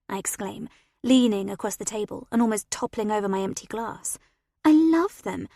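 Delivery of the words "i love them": excited. Acted. Excited about the band.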